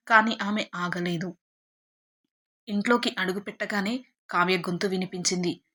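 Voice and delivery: plain reading voice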